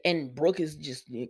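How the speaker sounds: ordinary delivery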